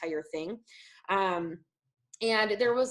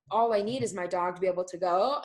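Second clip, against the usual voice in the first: ordinary talk, nothing marked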